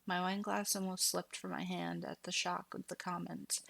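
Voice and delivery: ordinary delivery, nothing marked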